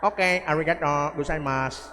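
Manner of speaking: hint of a Japanese accent on arigato gozaimasu; lightly imitating a Japanese doctor's thanks; mock Japanese accent. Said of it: "Ingon sa Japanese". (gaan nga Japanese nga accent)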